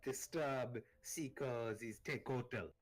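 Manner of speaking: slow, drawn-out guardian bellow; booming guardian voice. Acted. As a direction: drag it out like a temple spirit. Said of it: "a deep bass voice".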